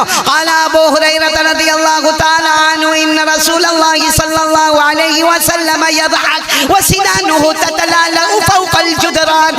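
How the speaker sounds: chanted hadith recital; Arabic hadith recitation. Acted. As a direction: intone the Arabic like scripture.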